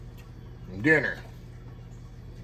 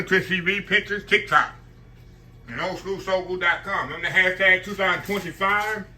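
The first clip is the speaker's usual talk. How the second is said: higher, louder outro pitch to subscribers; upbeat channel sign-off; 5 semitones higher, 4 dB louder than usual. Acted. Host closing his cooking video for viewers, upbeat sign-off. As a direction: sell the outro like a host wrapping up the show.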